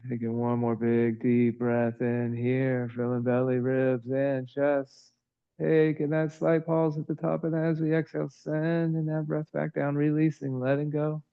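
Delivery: calm, even yoga-teacher voice with long pauses; soothing, evenly paced breath cues; guided breathing meditation. Real, not acted. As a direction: guide the breath gently, in an unhurried monotone.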